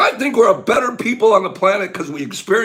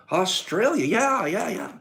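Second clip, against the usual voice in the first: ordinary talk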